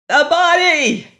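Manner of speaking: high, loud frantic scream; piercing desperate cry. A narrator's reading. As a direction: scream it in horror at the crowd. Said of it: "Lois screamed".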